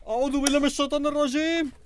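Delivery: high, fast panicked prayer; terrified prayer. Acted. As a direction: gabble it out in terror. Terrified man seeks refuge.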